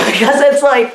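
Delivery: ordinary talk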